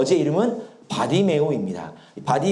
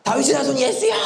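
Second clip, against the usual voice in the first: restrained high-pitched cry for help; acted yell, not at full force; blind beggar's cry; 8 semitones higher than usual. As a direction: imitate a beggar calling out, holding back for a sore throat. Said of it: "뭐라고 지릅니까?".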